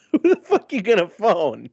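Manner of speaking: laughing loudly through the question; cracking up mid-sentence. Real, not acted. Laughing at phone a friend.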